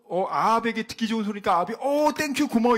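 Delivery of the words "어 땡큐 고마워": pleased thanks. Retold or imitated. Ahab pleased by flattery.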